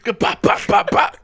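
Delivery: punchy "bop" sound effects; loud imitated bangs. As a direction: fire off loud mouth-made bops. Imitates noise in film scene.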